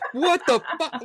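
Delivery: a ghost recoiling from rude guests; startled ghost. Pretend. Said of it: "I'm like".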